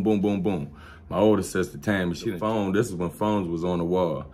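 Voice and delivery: ordinary talk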